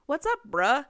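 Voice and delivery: loud, high, overdone nonchalance; forced casual. Acted. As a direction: try far too hard to sound chill. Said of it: "tried so hard to be, like, casual".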